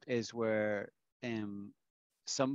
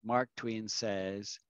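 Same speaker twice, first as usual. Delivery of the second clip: slow, deliberate; much slower than usual. Shown as a demonstration. Teacher stresses attribution slowly.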